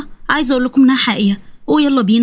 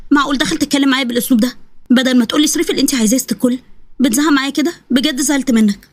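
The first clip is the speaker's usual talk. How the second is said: ordinary delivery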